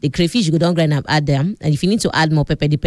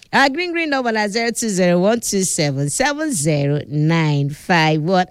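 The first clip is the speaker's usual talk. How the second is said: usual speaking voice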